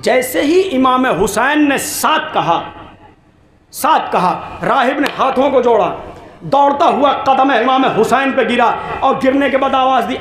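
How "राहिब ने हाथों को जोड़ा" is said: normal talking voice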